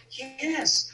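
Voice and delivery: put-on small, high child's voice answering; inner child voice. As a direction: answer like a scared little boy. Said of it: "I talked to my inner child".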